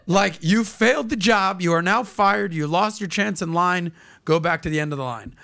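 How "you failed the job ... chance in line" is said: ordinary talk, nothing marked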